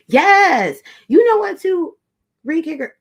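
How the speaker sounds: excited yes. Real, not acted. Streamer loving a viewer's comment, loud and thrilled. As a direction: jump in loud and high, fully agreeing.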